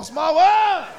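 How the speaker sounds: put-on kids hollering. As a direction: holler it out like an unruly kid, stretching the last word.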